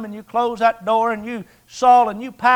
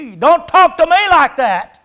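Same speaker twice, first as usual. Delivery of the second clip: sharp, louder and higher comeback; indignant wife's retort; 5 semitones higher, 5 dB louder than usual. Retold or imitated. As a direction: bristle back at your husband's tone. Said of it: "respond to the tone of voice".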